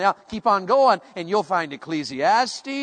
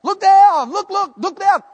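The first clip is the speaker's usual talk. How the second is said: loud, high, rapid-fire commands; urgent fatherly warning; 12 semitones higher, 10 dB louder than usual. Acted. Father telling his sons to avert their eyes, urgent shouting. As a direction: shout it like an alarm to the boys. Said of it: "So I'm telling my sons".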